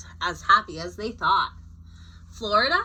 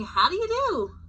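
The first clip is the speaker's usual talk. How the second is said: polite, high greeting; 8 semitones higher, 3 dB louder than usual. A narrator's reading. Reading Alabama's speech bubble.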